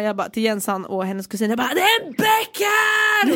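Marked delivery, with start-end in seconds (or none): 1.7-3.3 high, drawn-out warning shout; skrikande varning. Retold or imitated. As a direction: yell it desperately to friends down the slope. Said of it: "försöker liksom skrika till de här andra". (skrikande varningsrop, högt och utdraget)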